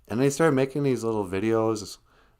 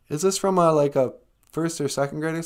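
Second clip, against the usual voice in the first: usual speaking voice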